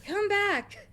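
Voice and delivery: high-pitched retold call; yelling up the street. Shopkeeper calling the ladies back, yelling up the street. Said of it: "yells up the street".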